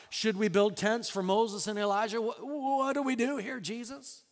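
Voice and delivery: high, loud, stammering panic; flustered blurting. Retold or imitated. Terrified Peter on the mountain, flustered blurting. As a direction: blurt it out high, then trail into a small question. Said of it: "Peter just blurts out".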